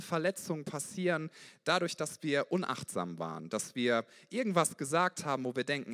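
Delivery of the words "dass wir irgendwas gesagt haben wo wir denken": ordinary talk, nothing marked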